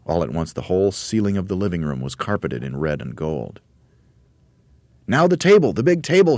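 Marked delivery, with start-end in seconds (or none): 5.1-6.4 high, loud excited order; Mugglewump shouting. A narrator's reading. Monkey leader to helpers, shouting. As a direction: shout out the next target. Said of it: "shouted Mugglewump".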